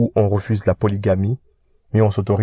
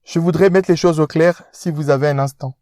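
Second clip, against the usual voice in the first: pompous rich fiancé, pitched up; posh fiancé; 7 semitones higher than usual. Acted. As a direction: speak down to him like an aristocrat.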